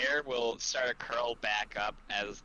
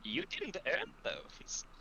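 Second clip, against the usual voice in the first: high, slow, airy dearie voice; high-pitched dainty scolding, thinning to a whisper; Fanny; 10 semitones higher, 3 dB quieter, slower than usual. Acted. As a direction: chide sweetly in a drawn-out falsetto. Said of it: "Fanny will go over to V and will say".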